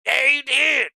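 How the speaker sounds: high, sing-song mock gratitude; mock reply to a donor. Streamer imagining thanking a crypto donor, high mock voice. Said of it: "And I'll be like".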